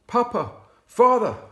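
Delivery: loud, high anguished cry to his father. A narrator's reading. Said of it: "Jesus cried".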